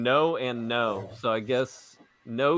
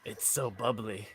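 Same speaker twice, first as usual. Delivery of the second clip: soft mock tipsy; 3 semitones lower, 13 dB quieter than usual. Mocking seltzer drinker.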